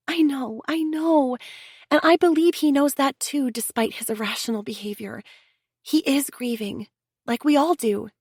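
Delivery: calm her friend down; Chelsea, soothing. A narrator's reading. Chelsea voiced, comforting Leona.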